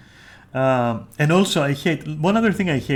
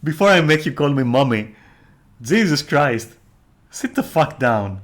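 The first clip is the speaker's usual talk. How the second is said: louder, laughing as it starts, mock bossy put-down; mock dominant snap; 3 semitones higher, 6 dB louder than usual. Streamer mock-scolding a flirty switcher, loud, bossy and half-laughing. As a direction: play the bossy mommy telling them off. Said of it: "And you're like".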